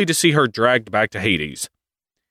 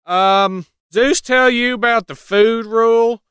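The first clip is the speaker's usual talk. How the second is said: much higher, smug sneaky voice; sly Hades; 12 semitones higher than usual. Retold or imitated. Hades smugly springing the food rule on Demeter, high and sly. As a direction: tease her with a loophole. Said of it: "Hades is like".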